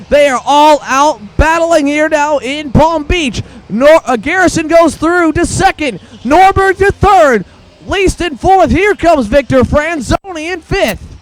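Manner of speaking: high excited race call; motorsport commentator. Real, not acted. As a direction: ride the battle with excitement. Everyone battling for positions.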